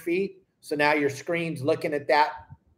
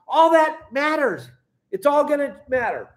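high, loud insistence that it all matters; emphatic; 9 semitones higher, 4 dB louder than usual. Real, not acted. Fishing guide to his students, high and insistent. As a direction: drive home that every detail counts.

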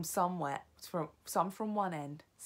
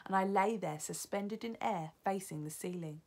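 plain reading voice

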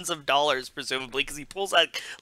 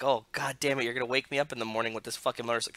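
imitating a grumpy dad, low, quick grumble; 4 semitones lower, 8 dB quieter, much faster than usual. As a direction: grumble it softly and fast, as a mock-weary father. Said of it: "his grumpy dad".

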